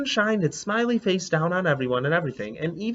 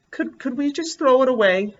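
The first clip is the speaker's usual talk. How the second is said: put-on higher, hesitant kid's stammer; timid question; 8 semitones higher than usual. Lily asking the teacher, a shy stammering kid. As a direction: ask shyly like a nervous little girl.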